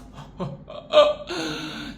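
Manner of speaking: a burst of high laughter. Real, not acted. Laughing at Andy's rough play, a high real laugh. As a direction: let the laugh jump up high.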